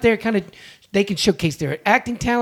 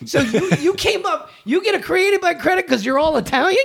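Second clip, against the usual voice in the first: retold indignant rant, pitch leaping high; worked-up mock outrage; mad; 11 semitones higher than usual. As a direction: fire back in exasperated, high-pitched disbelief. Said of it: "he made me so mad".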